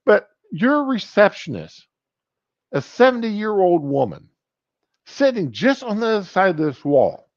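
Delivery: high-pitched, acted objection; mock indignant protest. Patient warning doctor the receptionist may overhear, mock indignant. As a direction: press the point with voice held high.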